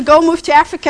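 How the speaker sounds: playful booming instruction; mock command. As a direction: bark it like a sudden divine command. Imagined command from God.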